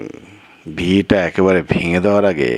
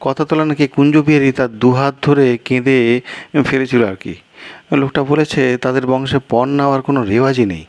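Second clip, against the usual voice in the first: higher and quicker, eager to explain; the guest rattles on, pitched up; fast, higher guest voice; higher-voiced guest; 8 semitones higher, faster than usual. Guest character voice.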